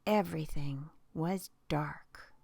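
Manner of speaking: plain reading voice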